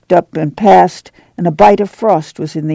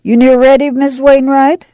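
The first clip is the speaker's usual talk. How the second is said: calling out; 9 semitones higher than usual. A narrator's reading. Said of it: "she called".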